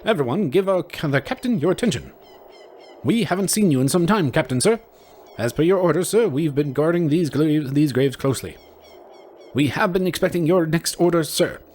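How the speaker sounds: high, nervous skeleton guard; squeaky, dutiful skeleton soldier. Acted. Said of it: "I don't know what this skeleton voice is".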